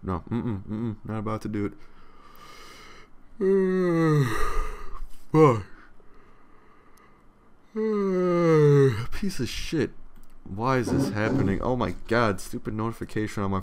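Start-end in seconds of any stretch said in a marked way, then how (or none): 1.8-5.3 long high stretched yawn; big yawn. Real, not acted. Streamer losing the fight against a yawn, long yawn. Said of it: "I feel another one coming now". 7.7-9.1 long high stretched yawn; big yawn. Real, not acted. Streamer losing the fight against a yawn, long yawn. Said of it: "I feel another one coming now".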